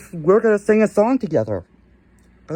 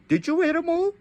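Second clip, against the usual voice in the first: accusing squeal; 11 semitones higher, faster than usual. Acted. Kid accuses Pig Trooper.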